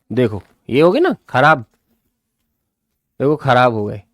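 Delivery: ordinary talk, nothing marked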